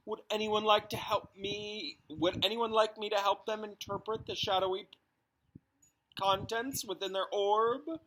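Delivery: high, theatrical fortune-teller voice; mystic seer. A narrator's reading. The divination teacher addressing her class, high and mystical. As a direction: play the grand, otherworldly seer. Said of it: "she muttered".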